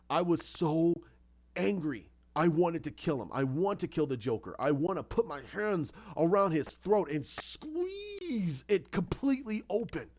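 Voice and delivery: acted angry confession, building in intensity; wrung-out fury, word by heavy word; anguished rage. Bruce confesses murderous rage.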